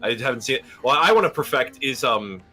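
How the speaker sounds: ordinary talk, nothing marked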